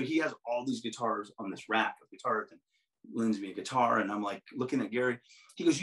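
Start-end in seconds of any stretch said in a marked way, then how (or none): none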